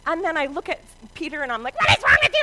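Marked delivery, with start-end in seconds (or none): 1.8-2.4 high, loud garbled mock tirade; hammed-up mom losing it. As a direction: rattle it out into furious gibberish. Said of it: "And losing it on him".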